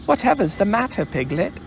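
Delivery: Christopher Robin. A narrator's reading. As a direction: ask briskly as a puzzled boy. Boy greets frightened Piglet.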